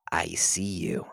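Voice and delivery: breathy unvoiced ghoul whisper. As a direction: whisper it like something dead inside him. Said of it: "the chilling voice of a ghoul".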